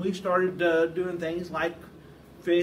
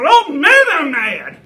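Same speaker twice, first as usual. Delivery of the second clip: high, booming mock rage; hammed-up furious outburst; feigned fury; 9 semitones higher, 17 dB louder, slower than usual. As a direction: blow up in put-on anger. Pretends fury to trick his friend.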